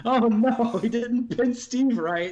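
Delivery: mock dismay. Real, not acted. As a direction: groan at the result. Reacting to the booking result.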